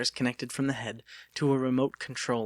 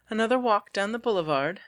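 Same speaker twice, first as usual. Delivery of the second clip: put-on lightly higher, genteel young lady; a refined young woman teasing her suitor; Delia's voice; 9 semitones higher, 4 dB louder than usual. Delia telling Lucas about her dolls, lightly higher voice.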